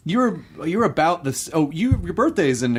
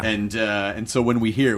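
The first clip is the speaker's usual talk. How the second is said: ordinary talk, nothing marked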